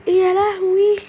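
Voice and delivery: high, loud frightened gasps of disbelief; panicking at a horror update; startled fright. Real, not acted. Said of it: "انا اتخضيت".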